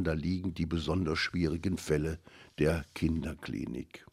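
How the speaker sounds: ordinary delivery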